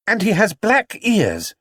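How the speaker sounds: put-on a keen child adding detail; Judy voice. Judy telling the detective, high and eager.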